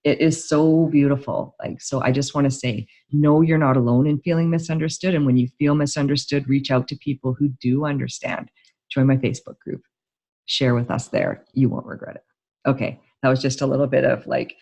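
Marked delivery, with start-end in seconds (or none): none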